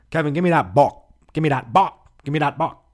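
put-on loud, high, insistent Taiwanese-accented demand; hammed-up Taiwanese man's accent, chopping off "box"; mock Taiwanese accent. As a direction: bark it out in a badly faked Taiwanese accent, "bok" for box. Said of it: "And it sounded like".

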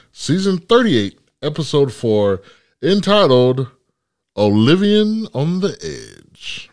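slow, high, dramatic title read; announcer voice. Acted. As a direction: build up the episode title. Host announcing episode title.

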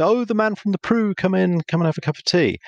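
high-pitched, gushing householder welcome; delighted welcome. Retold or imitated. Householder welcoming the trusted Prudential man at the door, delighted. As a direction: greet a familiar caller eagerly, pitched well up.

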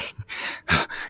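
imitated out-of-breath gasp; winded gasp. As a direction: gasp as if catching your breath after a hard run. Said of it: "really trying to catch my breath".

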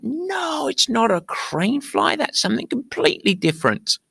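squeaky-high put-on protest, stretched out; mock shouted protest. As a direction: imitate a shouted objection in a high voice. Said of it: "both Kelly and Baxter shout".

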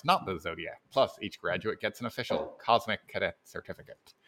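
put-on old Hollywood transatlantic announcer voice; grand, glamorous old film star ad read; transatlantic accent. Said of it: "my poor attempt at a transatlantic accent".

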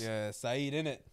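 lightly put-on London roadman accent, chill. To co-host, in a light London roadman accent. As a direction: play a London roadman, a hint of it.

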